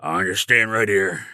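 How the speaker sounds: slow and low, going nowhere; lightly voiced immovable guard; slow stubborn guard. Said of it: "his mode of movement is pretty sedentary".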